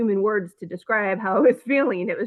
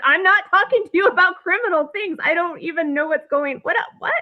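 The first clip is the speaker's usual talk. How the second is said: high-pitched retold panic; freaking out; 8 semitones higher than usual. Client protesting to the business lawyer, freaking out. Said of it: "I'm totally freaking out".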